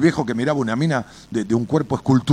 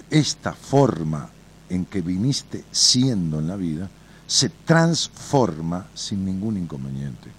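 slow emphatic advice; 3 semitones lower, slower than usual. Real, not acted. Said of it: "slow, emphatic counselling".

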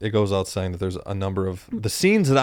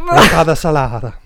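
loud hammed-up Italian accent; mock Italian; 5 semitones higher, 11 dB louder than usual. Correcting the dish name in mock Italian accent. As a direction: savor it like an Italian chef.

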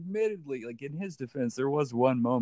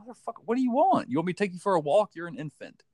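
put-on fast, higher exasperated rant at a baby; mock frustrated parent; 6 semitones higher, 4 dB louder than usual. Parent at wit's end with a crying baby, fast exasperated mock rant. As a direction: play the fed-up parent yelling at an infant.

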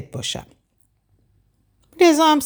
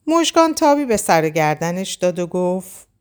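usual speaking voice

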